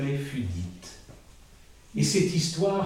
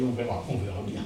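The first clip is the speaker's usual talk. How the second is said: quiet; 6 semitones lower, 7 dB quieter, faster than usual. Acted. The wise one answers calmly.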